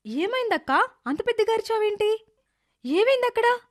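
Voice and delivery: worried sister. Acted. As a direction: ask in growing alarm, pitch climbing. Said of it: "దీంతో నీలిమ కంగారు పడుతోంది".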